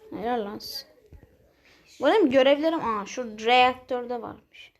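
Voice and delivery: ordinary talk, nothing marked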